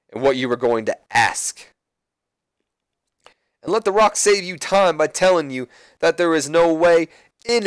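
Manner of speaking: lightly voiced Rock scolding Kurt. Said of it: "and he goes".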